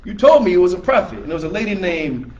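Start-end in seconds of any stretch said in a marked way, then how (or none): 0.0-1.2 higher, louder jeering quote; mocking Meccans jeer the prophet, higher and louder; mocking taunt. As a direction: taunt him like a scornful crowd. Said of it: "the Meccans were mocking him".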